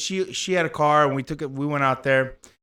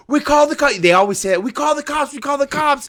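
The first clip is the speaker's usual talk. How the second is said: much higher "We call the cops"; mocking the shopkeepers, pitched way up; shop owners threatening; 9 semitones higher, 3 dB louder than usual. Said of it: "people started, you know".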